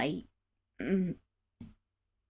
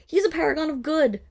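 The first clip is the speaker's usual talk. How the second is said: ordinary talk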